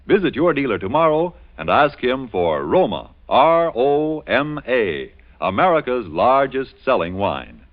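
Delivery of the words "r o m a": R O M A, each letter slow and separate; spelled out slowly. Acted. Announcer spells the brand for listeners, slow and deliberate.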